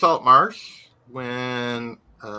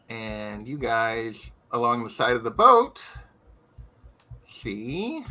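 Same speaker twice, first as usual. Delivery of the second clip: usual speaking voice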